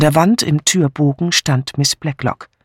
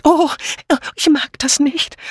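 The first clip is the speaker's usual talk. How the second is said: high-pitched whimpering complaint; loud wailing; 13 semitones higher than usual. A narrator's reading. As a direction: wail it high like a frightened lady. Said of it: "Dora Banner jammerte laut".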